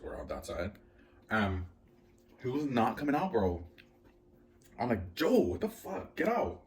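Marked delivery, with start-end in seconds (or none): 5.1-6.6 very high, slow, strained yell-whine at Joe; exasperated plea. Retold or imitated. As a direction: beg him to come out, at wit's end. Frustrated at neighbor not coming.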